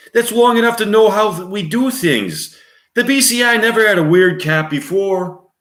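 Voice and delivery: hammed New York cop voice; New York accent. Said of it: "with a New York accent, male voice".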